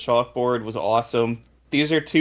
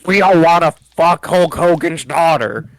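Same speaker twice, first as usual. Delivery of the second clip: mock JR outrage; 4 semitones higher, 5 dB louder than usual. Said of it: "and now he's like".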